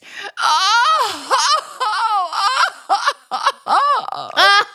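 wild high laugh breaking into a cry; hysterical laugh. Acted. Coach demonstrating a wild laugh of hysteria. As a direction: cackle with abandon, half sobbing. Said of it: "What are you laughing for?".

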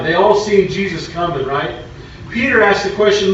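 loud, high, ringing pulpit delivery; full-force preaching. Acted. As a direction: preach it out to the whole congregation.

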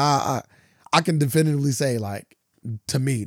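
usual speaking voice

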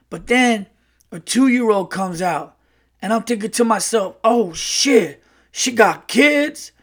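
high, animated brash uncle; Earl. Retold or imitated. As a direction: imitate a brash guy dawning on disaster, drawing out the punchline. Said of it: "like, a very brash uncle".

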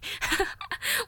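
a sudden breathy high laugh; airy amused laugh; burst of laughter. Real, not acted. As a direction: laugh it off lightly. Laughs at own indifference.

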